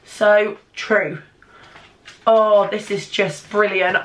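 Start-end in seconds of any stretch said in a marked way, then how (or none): none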